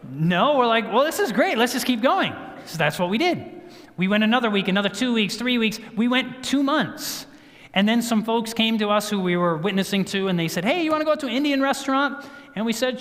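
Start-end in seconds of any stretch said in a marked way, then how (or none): 0.9-2.3 high, bouncy retold enthusiasm; upbeat resolve. Couple agreeing to stay off spice, acted upbeat. 10.6-12.2 high, quick imitation of an eager invite; friendly invitation. Friends inviting the couple to dinner, imitated eagerly.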